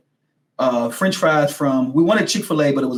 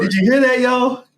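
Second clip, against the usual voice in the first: calling out to viewers; 9 semitones higher, 5 dB louder than usual. Real, not acted.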